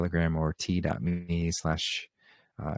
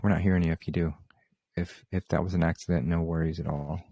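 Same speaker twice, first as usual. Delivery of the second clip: ordinary delivery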